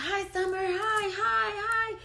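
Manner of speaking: high sing-song mimic of the ex calling her name; mocking ex's greeting. Mimicking her ex greeting her, high sing-song. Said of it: "he's like".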